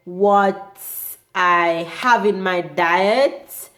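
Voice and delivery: ordinary delivery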